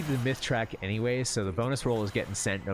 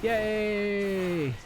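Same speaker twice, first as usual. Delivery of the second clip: sarcastic yay; 12 semitones higher than usual. Real, not acted. Sarcastic cheer at bad group.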